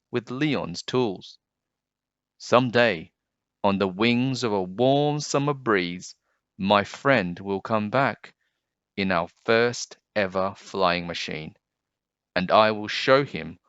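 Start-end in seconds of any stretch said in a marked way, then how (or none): none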